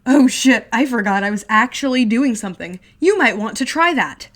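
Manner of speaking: high, loud sarcastic jeer; older brother jeering loudly; mocking shout. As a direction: jeer at him.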